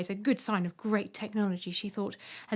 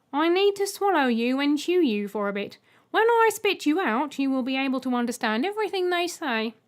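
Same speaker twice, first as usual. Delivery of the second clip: louder, stiff programmed welcome; robot egg Mip; 4 semitones higher, 5 dB louder than usual. Acted. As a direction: speak like a dutiful machine. Mip insists on chewing her.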